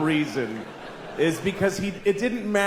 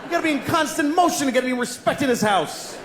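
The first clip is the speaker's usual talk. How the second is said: indignant fish; 8 semitones higher, faster than usual. Acted. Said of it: "it looks like he goes".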